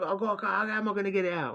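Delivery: high, fast, imitating a frantic friend; frantic worry. Friend frets how to get it out.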